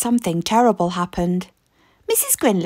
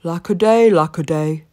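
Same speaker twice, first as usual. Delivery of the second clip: sad; 4 semitones lower, 3 dB louder than usual. Acted. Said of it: "said Mister Grinling sadly".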